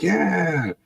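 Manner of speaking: drawn-out, high excited yeah; mildly excited kid's cheer; eager agreement. Retold or imitated. Kids excited about vacation plan.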